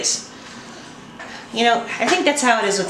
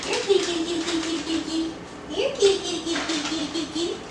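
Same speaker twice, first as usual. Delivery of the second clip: high, soft cooing; 6 semitones higher, 6 dB quieter than usual. Real, not acted. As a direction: coo at the kitty sweetly. Cooing at the cat.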